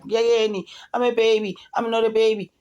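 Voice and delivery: a hammed-up agitated daddy's girl; mock agitated whine. Mocking agitated Angel.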